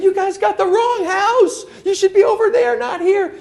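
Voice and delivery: hammed-up outraged homeowner; mock yell. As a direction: shout up at the roofers in disbelief. Neighbor yelling at roofers.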